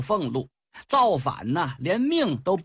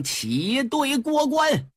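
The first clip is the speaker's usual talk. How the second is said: higher, slow drawn-out battle order; loud command; 8 semitones higher, much slower than usual. Acted. Commander orders his troops through the pass, slow loud cry. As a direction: bellow the order to march through. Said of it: "黄飞虎高喊一声".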